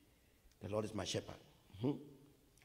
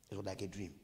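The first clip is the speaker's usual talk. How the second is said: ordinary delivery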